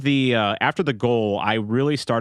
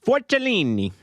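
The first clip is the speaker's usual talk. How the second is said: Italian pronunciation; 12 semitones higher than usual. Shown as a demonstration. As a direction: say it with a showy Italian lilt. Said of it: "the proper Italian pronunciation".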